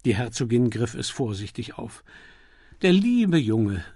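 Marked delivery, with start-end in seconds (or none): 2.8-3.8 slow, higher and louder, fondly; fond smile. Acted. The Duchess smiles fondly at mention of her son, slow. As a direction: smile as you name your dear boy. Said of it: "lächelte sie".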